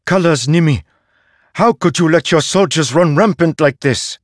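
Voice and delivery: high, loud, unyielding accusation; a mother who will not be moved; strong and firm. A narrator's reading. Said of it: "her voice strong like a towering tree, firm, immovable".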